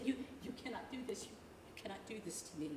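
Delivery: a quiet, rushed, shattered plea; broken despair. Acted. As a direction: crumble into disbelief. Collapsing after outburst.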